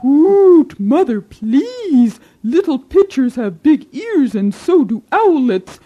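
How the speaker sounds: high hooting owl, slow warning; hoot, then hush her loudly; hooting father owl. Acted. Father Owl scolding his wife.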